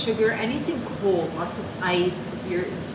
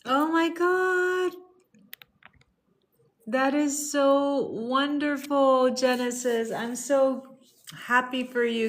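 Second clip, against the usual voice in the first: much higher, slow and gushing; overjoyed; 7 semitones higher, slower than usual. Real, not acted. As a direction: burst with delight for a friend's news. Viewer shares happy news.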